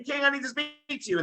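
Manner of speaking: high pleading mock shout; crying shout. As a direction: imitate a boy calling out desperately. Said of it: "he's still shouting and he's crying".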